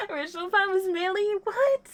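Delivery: high, rushed, astonished question; stunned disbelief. Real, not acted. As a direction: react in real shock, pitch shooting up. Stunned by news about the gift.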